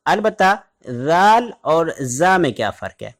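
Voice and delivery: ordinary talk, nothing marked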